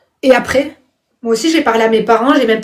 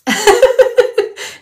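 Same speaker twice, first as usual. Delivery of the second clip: high-pitched burst of laughter; 9 semitones higher than usual. Real, not acted. Streamer amused by a viewer's comment, high laughter.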